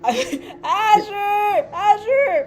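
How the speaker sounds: high, drawn-out calling from afar; screaming. Acted. Traveler calls Azure over by the fence, hammed-up screaming. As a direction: holler playfully to be noticed across the yard. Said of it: "I'm screaming".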